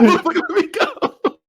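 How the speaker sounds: high squeal through laughter; mock pained yell. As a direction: imitate the pained villain while cracking up. Retold villain yelling as hand cracks.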